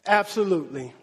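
ordinary talk, nothing marked

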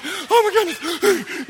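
high, loud, slow panicked cry; panicked oh my goodness. Retold or imitated. Preacher reliving the rapids, panicked cry. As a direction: drag it out like you're drowning. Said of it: "I'm like".

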